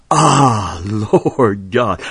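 a drawn-out "Ah" leading the audience into "Lord God"; emphasized Ah. Shown as a demonstration. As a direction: draw out "Ah" as if for the congregation to repeat. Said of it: "say that with me".

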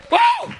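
sudden high shouted woo; high preacher whoop. Acted. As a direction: let out a joyful whoop.

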